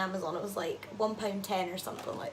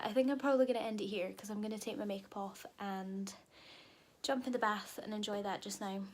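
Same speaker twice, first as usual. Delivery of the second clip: hushed late-night whisper; 7 dB quieter than usual. Real, not acted. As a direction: whisper so nobody wakes. Late-night quiet vlog talk.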